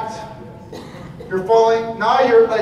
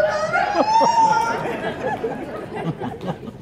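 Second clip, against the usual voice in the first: high shrieking laugh; 4 dB quieter than usual. Real, not acted. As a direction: crack up in a shriek. Bursts out laughing.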